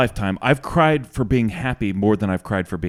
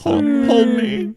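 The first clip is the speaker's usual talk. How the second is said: mock sob; 11 semitones higher, much slower than usual. Mock crying plea.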